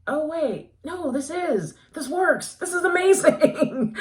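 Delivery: high, building to a loud thrill; excited realization. Retold or imitated. Reader retelling the moment the book won her over, excited realization. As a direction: let the discovery mount to a high, loud peak. Retold growing thrill while reading.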